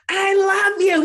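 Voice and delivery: high, loud sing-song I love you; loud embarrassing I love you. Retold or imitated. Mom calling into son's gaming headset, high and loud. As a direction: belt it out to embarrass him in front of his friends.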